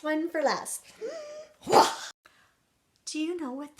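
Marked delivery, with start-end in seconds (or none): 1.7-2.1 loud theatrical gasp; sudden sharp storybook gasp. Acted. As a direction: draw in breath dramatically. Saves best trick for last.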